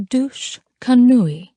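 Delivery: put-on douche canoe in a light French accent, slow. Teaching her American phrase, Elise says it in a French accent. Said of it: "with the most impeccable French accent".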